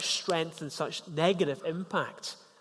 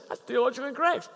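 much higher, a preachy correcting tone; mock know-it-all correction; 10 semitones higher, much faster than usual. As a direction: play the tactless corrector, pitched up. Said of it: "Don't say".

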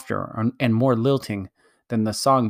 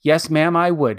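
higher, louder huffy retort; indignant outburst; 6 semitones higher, 5 dB louder than usual. A narrator's reading. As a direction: snap back in wounded pride. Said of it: "temper at last got the better".

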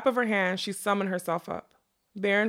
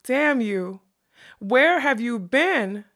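loud, drawn-out furious demand; curse, then demand it slowly, higher and louder; heated, accusing outcry; angry cry; 4 semitones higher, 6 dB louder, much slower than usual. A narrator's reading. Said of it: "she cried".

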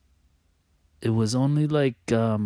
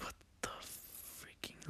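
breathless whisper of disbelief; whispered shock; 25 dB quieter, slower than usual. Retold or imitated. Whispering on hearing mom saw a woman by her bed. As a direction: hush it, half to yourself. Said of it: "in my mind".

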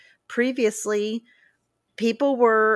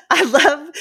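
ordinary talk, nothing marked

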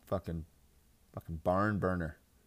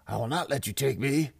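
hammed-up dying politician, raised and loud; dying Bernie; 6 semitones higher, 6 dB louder than usual. Politician fighting off Father Death, mock dying defiance. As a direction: defy death in a put-on throaty cry. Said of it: "sounded like he was dying".

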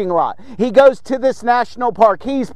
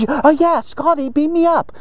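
higher-pitched mock sci-fi call; playful Star Trek captain; 5 semitones higher than usual. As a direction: pretend to radio the ship, playfully. Playing Star Trek on flip phone.

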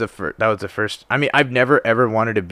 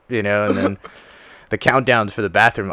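usual speaking voice

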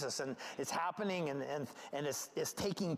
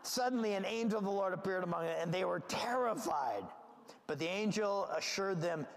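ordinary delivery

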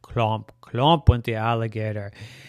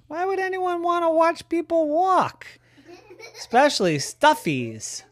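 high doubtful voice. Acted. Cow objects to parade.

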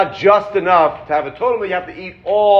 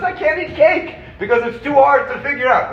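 mock despair; 9 semitones higher, 3 dB louder than usual. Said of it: "Rav Moshe says".

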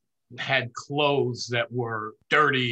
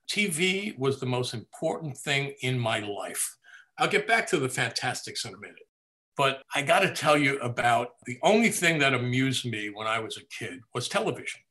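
ordinary talk

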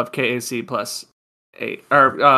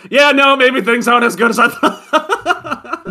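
laughing through it; 7 semitones higher than usual. Acted. Player laughing at his own sweaty selfie, laughing. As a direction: giggle the line out as you read it.

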